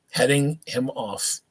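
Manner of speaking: ordinary delivery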